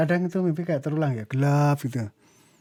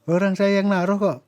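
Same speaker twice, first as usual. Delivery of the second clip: retold protest, raised pitch; stubborn insistence; 5 semitones higher, 4 dB louder than usual. Son contradicting his doubting mother about the chickens, stubbornly insistent. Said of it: "Tapi saya ngotot".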